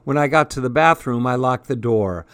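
usual speaking voice

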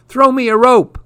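very high, loud screamed plea; sinking boy screaming for a rope. A narrator's reading. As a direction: scream it as the bees come. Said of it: "He screamed".

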